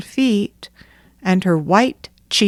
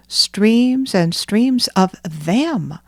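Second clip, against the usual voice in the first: plain reading voice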